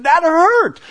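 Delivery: put-on high, loud tearful cry of a hurt child. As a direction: voice the son sobbing out his hurt. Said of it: "He was crying and he was upset".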